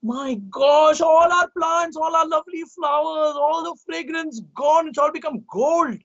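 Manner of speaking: lightly voiced gardeners in dismay; gardeners' dismay. A narrator's reading. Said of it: "they were all like".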